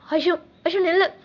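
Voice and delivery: calling after someone. Acted. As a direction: call out to stop someone leaving. Calling after departing lover.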